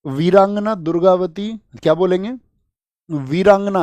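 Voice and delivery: वीरांगना called out high and loud, each syllable clear; drilling the word. Shown as a demonstration. Teacher making pupils memorise वीरांगना, calling it high and loud. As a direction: belt out वीरांगना for the class to repeat. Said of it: "ऐसे याद रखना".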